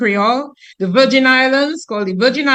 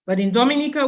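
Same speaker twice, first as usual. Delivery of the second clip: normal talking voice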